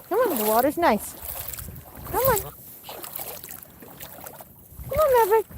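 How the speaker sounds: high sing-song call; calling pets. Real, not acted. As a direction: coax them in a high voice.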